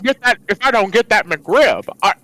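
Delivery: stammering, desperate addict in character; Jimmy Stewart. Acted. Jimmy confessing a McRib addiction, stammering desperately. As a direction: stutter through a hammed-up confession. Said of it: "I'm Jimmy Stewart and I'm jonesing".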